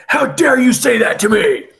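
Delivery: furious pirate shout. Retold or imitated. Pirate raging at the doctor who told him to shut up, shouting. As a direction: bark it as an insulted brute. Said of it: "the pirate freaks".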